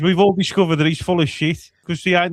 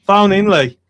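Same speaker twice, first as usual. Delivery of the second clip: calling out; 2 semitones higher, 10 dB louder, slower than usual. Real, not acted. Calling a listener to ring in.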